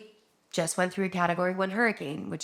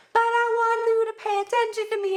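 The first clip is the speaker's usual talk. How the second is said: put-on much higher, a small child begging for attention; whiny kid voice; 3 dB louder than usual. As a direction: pitch it way up like a needy little kid. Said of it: "the kids are like".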